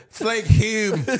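Irish-Philly accent. Shown as a demonstration. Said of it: "our Irish goes to Philly".

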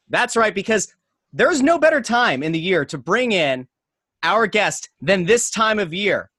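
big hype announcer build-up. Acted. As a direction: introduce him like a ring announcer. Hype intro for the guest.

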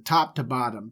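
usual speaking voice

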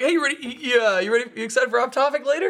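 mock high-pitched flustered stammer; distracted deflection. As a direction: imitate someone hurriedly changing the subject, high. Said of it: "you immediately went into distraction mode".

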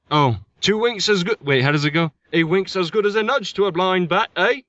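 badly faked British accent, playfully; terrible English accent. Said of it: "That was a terrible English accent".

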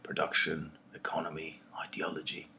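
whispered as if taboo words; mock hush. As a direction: drop to a conspiratorial whisper. Naming topics poets shun.

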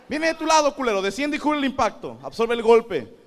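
high, loud commanding voice; brain commanding. Retold or imitated. Brain ordering the arm to block, high commanding voice. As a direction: give battle orders to your arm.

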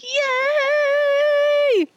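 high squeal of joy; mock cheer. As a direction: cheer like you just won. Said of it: "you're like".

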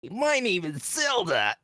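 lightly mock the cartoon Zelda; cartoon character voice. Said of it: "he has this, like".

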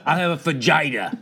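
laughing mock order. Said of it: "That sounded dirty".

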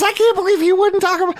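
very high put-on wife voice; mock woman's voice. Husband mimicking his wife's disbelief, in a mock high woman's voice. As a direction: imitate your wife in an exaggerated high register. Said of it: "When you make that voice for women".

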